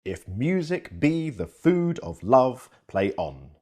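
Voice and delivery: slow, stressing MU, BE, FOOD, LOVE and ON in "If music be the food of love, play on"; measured light-strong beats, high and loud then dropping at "play on"; marked iambic stress. Shown as a demonstration. Lecturer marking unstressed and stressed syllables, slow iambic recital. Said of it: "A curve for unstressed syllables".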